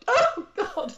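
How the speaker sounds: high, loud, laughing wail; mock sobbing. After failing the tests, laughing mock sob. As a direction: ham up the tears while laughing. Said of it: "I was, like, sobbing".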